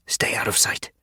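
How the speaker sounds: whispered instruction. Acted. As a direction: keep it hushed, hiding from danger. Hiding near a patrol.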